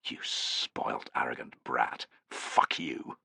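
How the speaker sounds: venomous whisper to oneself; low, hushed private insult; inner thought. A narrator's reading. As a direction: curse him under your breath. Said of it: "Tullus thought".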